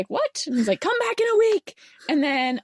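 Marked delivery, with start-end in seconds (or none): 0.1-0.4 high startled squeak; mock shock. Voicing confused friend. 0.8-1.8 high, louder insistence; mock scientist. Voicing the scientist.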